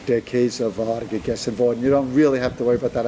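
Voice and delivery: ordinary delivery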